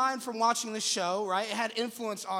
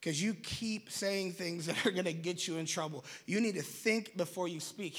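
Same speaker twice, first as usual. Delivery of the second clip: mom's advice; 5 semitones lower, 7 dB quieter than usual. Retold or imitated. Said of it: "My mom used to always tell me".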